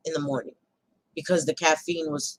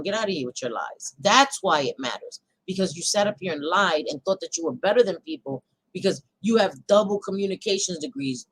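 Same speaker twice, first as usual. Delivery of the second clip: ordinary talk, nothing marked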